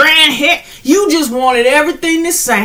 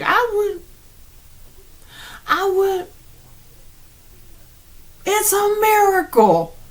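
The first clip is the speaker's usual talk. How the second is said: awed; 7 semitones higher, 5 dB quieter than usual. Retold or imitated.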